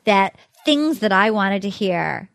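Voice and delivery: slow, measured pronouncement; mock solemn dismissal. Host imitates her dismissing Thomas, mock solemn. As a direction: deliver it word by word like a formal verdict. Retells rose ceremony dismissal speech.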